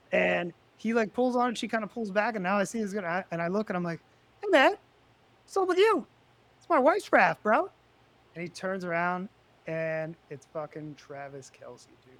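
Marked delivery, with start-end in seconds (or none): 4.4-7.7 high, loud calling out across the pool; retold confrontation. Retelling confronting the raft thief, a loud high call-out. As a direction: snap it at him as if catching a thief.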